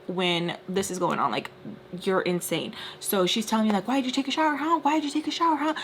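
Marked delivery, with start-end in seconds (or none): 3.9-5.7 accusing wife. Retold or imitated. Retold angry wife interrogating her.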